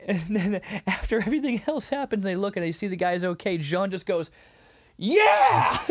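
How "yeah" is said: very loud, high whooping yell; imitated scream. Imitating John's reaction to the healed man, screamed cheer. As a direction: scream it out in modern-style celebration.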